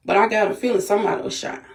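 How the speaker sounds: normal talking voice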